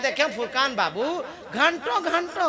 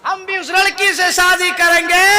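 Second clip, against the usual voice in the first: raised high shout voicing the young men; loud declaration, higher; mock shouted vow; 9 semitones higher, 5 dB louder than usual. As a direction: belt it out like a proud groom-to-be. Preacher voicing young men's vow.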